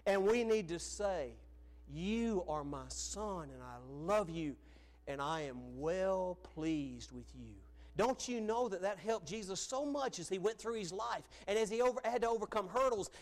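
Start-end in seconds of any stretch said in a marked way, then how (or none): 1.9-7.5 slow, tender and lower; deliberate, heartfelt fatherly blessing; fatherly affirmation. Acted. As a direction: tell a son slowly how loved he is. Models a father affirming his son.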